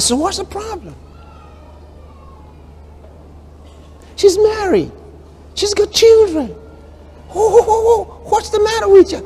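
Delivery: preacher mimicking his own astonishment; mock disbelief. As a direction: sound amazed she can be sad. Preacher retelling his own questions.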